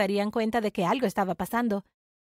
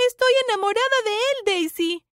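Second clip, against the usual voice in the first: high, pleading, raised voice; heated confession; 8 semitones higher, 4 dB louder than usual. Acted. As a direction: plead your love mid-quarrel. Confiesa en plena pelea. (confesión exaltada, en tono agudo)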